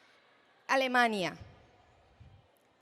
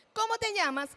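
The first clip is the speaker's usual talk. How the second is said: put-on high, friendly question to a child; bright, high-pitched question; adult asking; 7 semitones higher than usual. Shown as a demonstration. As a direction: ask it up high and inviting. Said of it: "tenemos también la ecolalia".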